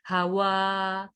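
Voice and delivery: हवा with the final "aa" held long; stretched last vowel. Shown as a demonstration. Teacher showing verse endings to stretch, हवा drawn out. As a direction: draw out the end of हवा slowly. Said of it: "इनको आपने खींचना".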